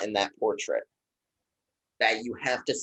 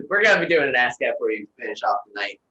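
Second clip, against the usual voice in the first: fast; 4 dB louder, faster than usual. Shown as a demonstration. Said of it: "contrast with slow take".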